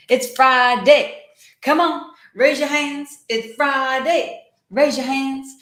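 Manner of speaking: high, loud hype-up cheer; excited rallying call. Real, not acted. Host urging viewers to raise their hands, excited call. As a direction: call out high to get the crowd going.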